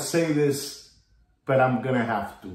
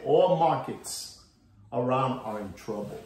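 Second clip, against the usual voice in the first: normal talking voice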